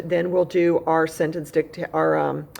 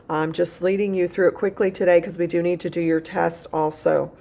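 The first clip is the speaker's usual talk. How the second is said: ordinary delivery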